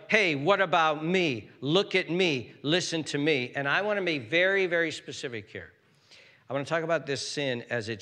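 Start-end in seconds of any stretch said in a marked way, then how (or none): 0.1-3.5 loud, higher cries for attention; attention-demanding. Acted. Preacher voices the selfish sinner, demanding attention. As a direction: act out a loud me-first demand.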